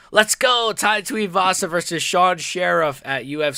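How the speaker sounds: loud, high cheer; excited hype. Real, not acted. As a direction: shout it out, hyped for the fight. Hypes a fight announcement.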